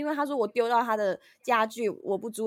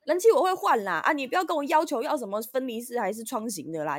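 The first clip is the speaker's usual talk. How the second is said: higher, louder exasperated reply, easing off; irritated snapping; 5 semitones higher, 6 dB louder than usual. Retold or imitated. Imitating the annoyed landlady as she leaves. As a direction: snap back in irritation. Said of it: "很恼火的跟我讲说".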